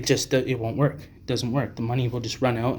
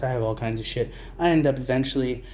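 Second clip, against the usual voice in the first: usual speaking voice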